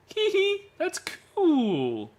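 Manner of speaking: put-on high-pitched girlish tee-hee read; high girlish giggle. Reading the girl's chat aloud in a high, giggly girl voice.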